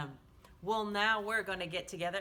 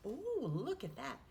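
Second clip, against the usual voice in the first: ordinary delivery, nothing marked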